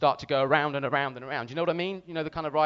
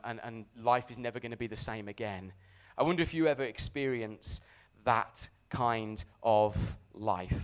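ordinary talk

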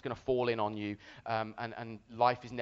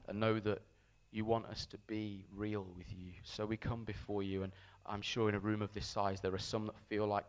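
soft, low-pitched earnest prayer; 5 semitones lower, 5 dB quieter than usual. Real, not acted. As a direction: pray quietly to God over the room. Leads a prayer.

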